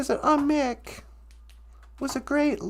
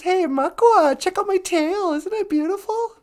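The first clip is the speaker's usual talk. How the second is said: boastful beaver; 6 semitones higher, 3 dB louder than usual. Acted.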